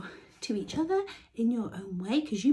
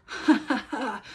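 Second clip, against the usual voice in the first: teasing laugh; 4 semitones higher, 5 dB louder than usual. A narrator's reading. Gingerbread man laughing.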